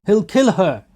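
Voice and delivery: loud, high cry; alarmed shout. A narrator's reading. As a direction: shout the warning. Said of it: "they shouted all round".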